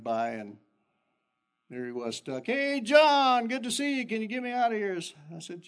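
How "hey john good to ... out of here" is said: put-on high, loud kid's voice; mouthy kid calling. Stuck kid calling to John for help, pitched up.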